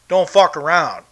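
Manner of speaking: ordinary delivery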